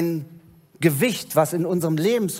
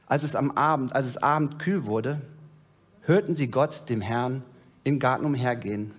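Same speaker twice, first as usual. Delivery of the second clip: gently lowered storyteller voice; scripture reading; 2 semitones lower than usual. A narrator's reading. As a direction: read the fall story steadily to the congregation. Reads the Genesis story aloud.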